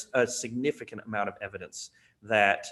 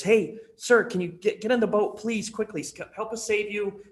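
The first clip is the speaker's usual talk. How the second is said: higher, pressing plea to get in the boat; urgent calling; 8 semitones higher, 3 dB louder than usual. Acted. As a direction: call out over the flood, high and insistent. Second boat urging the man.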